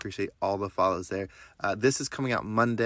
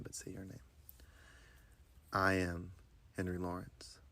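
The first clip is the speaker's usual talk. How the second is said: guided meditation; 2 semitones lower than usual. Acted. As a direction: guide them through breath and affirmations gently. Leads a breathing meditation.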